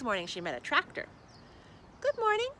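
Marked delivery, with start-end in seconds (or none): 2.0-2.5 high, louder little-truck greeting; shy little truckling. Acted. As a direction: greet the stranger hopefully, in a tiny high voice. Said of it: "said the ugly truckling".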